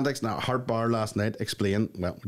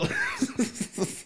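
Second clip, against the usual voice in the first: high squealing laugh; 4 dB quieter than usual. Real, not acted. Laughing at own joke.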